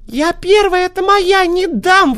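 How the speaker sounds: very high squealing fox; fox shrieking. A narrator's reading. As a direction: squeal it and lunge. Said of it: "вдруг взвизгнула лиса".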